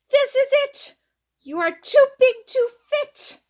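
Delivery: high, loud storybook shout; playful shout. Acted. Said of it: "Would you shout".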